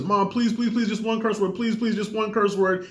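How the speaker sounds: lightly acted pleading, like a boy working on his mom; pleading son. Son begging mom for permission.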